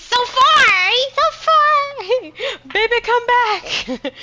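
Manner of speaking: high, drawn-out mock wail; long, high-pitched plaintive call; playful wail. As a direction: wail after someone far away, half laughing. Wailing for an out-of-reach ally.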